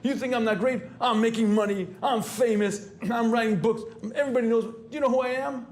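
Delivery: the inner ego talking back, boastful and high; arrogant boasting. Retold or imitated. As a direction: puff up and demand respect. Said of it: "you can almost hear the internal struggle".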